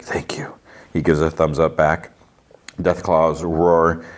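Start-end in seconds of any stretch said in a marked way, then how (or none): 0.0-0.5 hushed, whispered thanks; imitated grateful whisper. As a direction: whisper it under your breath. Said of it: "sighs and whispers".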